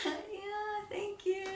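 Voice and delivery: high, lilting flirty "thank you"; singsong coy thanks. Retold or imitated. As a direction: sing it out, teasing and shy. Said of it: "I did exactly how I would do".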